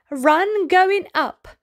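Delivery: ordinary delivery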